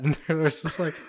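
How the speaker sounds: ordinary delivery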